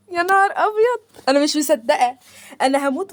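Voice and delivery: high, delighted squeal of surprise; can't believe her luck, gushing; oh my god, I can't wait; excited gasp. Real, not acted. Unboxing a longed-for mic.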